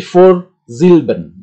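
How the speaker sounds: Vorsilben said slowly and clearly in German; German word model. Shown as a demonstration. Teacher to students: slow clear German model word. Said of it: "adalah suku kata yang berada di depan".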